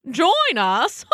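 puffed-up, mocking bird voice; proud scoffing lark. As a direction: scoff like a smug little lark.

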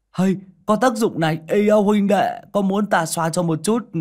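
high, loud, excited chirping; gleeful comic sidekick. A narrator's reading. Tiểu Minh offers powder to Chí Dũng, high and gleeful. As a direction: crow with delight and offer to share.

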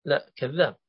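ordinary talk, nothing marked

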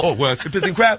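ordinary talk, nothing marked

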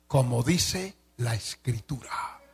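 slow whisper, breath only; whispered aside. Acted. Pastor whispers to the pastors, citing Scripture in a slow whisper. Aside after Greek phrase.

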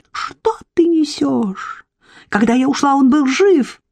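high, indignant self-defence; indignant outcry. Acted. Irka defends herself against the accusation, high indignant outcry. Обвинена в смерти, возмущается.

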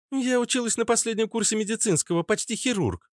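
put-on high-pitched girl's voice; redhead girl. A narrator's reading. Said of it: "ответила девушка". (высоким девичьим голосом)

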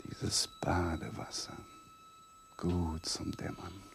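slow, hushed and sleepy; drowsy whisper. Acted. Man dozing in the bath, slow sleepy whisper. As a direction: murmur it as if dozing off.

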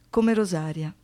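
ordinary talk

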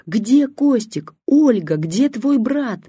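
высокий громкий медленный крик; кричи, растягивая каждое слово. A narrator's reading. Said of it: "продолжала кричать бабушка". (бабушка зовёт сына: протяжный высокий крик)

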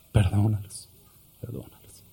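low, soft, then whispered away; fading plea. Acted. Jesus beaten, softly begging forgiveness, fading. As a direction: let the second one die into a whisper. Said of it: "el Señor decía".

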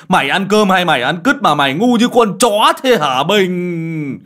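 loud, high, wailing rant; exasperated scolding. A narrator's reading. Uncle berating the boy for peeing there, loud despairing scolding. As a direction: scold at full volume, dragging out the name. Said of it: "mắng bác Bình".

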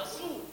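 thin out the s of رسول mockingly; mock overthin s. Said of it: "السين مرققة". (تقليد ترقيق مبالغ فيه في رسول)

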